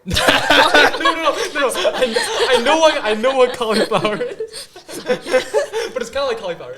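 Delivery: high, loud laughing denial; laughing protest. Real, not acted. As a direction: protest through your laughter. Denies it is cauliflower, laughing.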